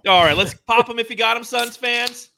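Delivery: fired-up show opener; hype host. Real, not acted. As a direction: pump up the fans. Hyping Suns fans.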